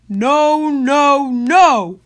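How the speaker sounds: high, loud villain shout. A narrator's reading. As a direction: bellow a stubborn refusal. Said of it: "And Paro shouted".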